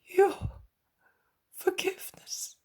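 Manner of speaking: tearful moan; moaning plea. A narrator's reading. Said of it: "and with changed countenance he moans".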